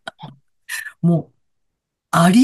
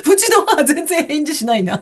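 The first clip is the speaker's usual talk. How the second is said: ordinary delivery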